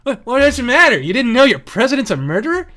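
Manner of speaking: imitating Norm, rising to a yell; Norm Macdonald impression. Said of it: "And Norm Macdonald goes".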